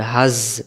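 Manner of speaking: হাজ ending in a long buzzing, whistled z; whistling z. Shown as a demonstration. Teacher showing the letter za, হাজ with a whistling z. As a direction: hold a whistle on the final z of হাজ. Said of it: "শীষ হবে".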